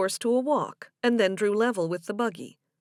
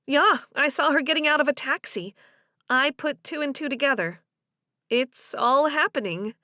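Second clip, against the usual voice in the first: higher woman's voice, pleased with her deduction; animated Delia; 5 semitones higher, 4 dB louder than usual. Acted. Delia tells Joe she put two and two together, animated. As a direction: play her sharing news, lifted in pitch.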